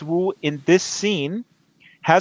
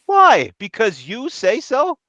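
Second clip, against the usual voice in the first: angry cop; 8 semitones higher than usual. Acted. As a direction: bark it back at him. Cop snaps at Willis.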